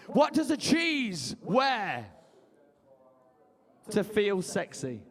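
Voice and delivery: slow joke setup. Shown as a demonstration. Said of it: "joke setup".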